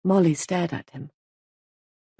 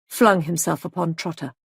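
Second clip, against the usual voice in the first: plain reading voice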